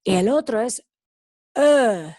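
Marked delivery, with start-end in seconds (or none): 1.5-2.2 "e" held as a loud French schwa, like "je"; drawn-out, emphatic "e" as in French je; French-style "e" vowel. Shown as a demonstration. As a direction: say "e" as a central uh with French rounding. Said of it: "No sé si alguien habla francés".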